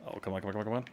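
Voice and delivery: normal talking voice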